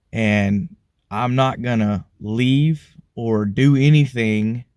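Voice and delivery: each word laid down slowly and firmly; slow, deliberate vow; measured and steady; slow resolve. Retold or imitated. Resolve after prayer.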